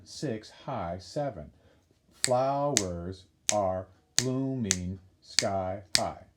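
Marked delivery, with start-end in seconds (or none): 2.1-6.2 syllable by syllable. Shown as a demonstration. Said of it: "Seven syllables".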